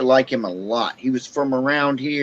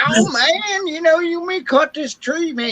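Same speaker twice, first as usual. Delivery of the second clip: imitating a Southern accent, pitched way up; mock Southern accent in a much higher voice. Retelling a friend's tale about a tree worker, high mock Southern accent. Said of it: "He's a freaking Southern accent".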